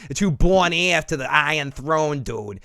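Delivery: playful Boston tough talk, rising in pitch; Boston accent. Pretend. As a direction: rant like a mock Bostonian. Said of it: "I sounded Boston there for a second".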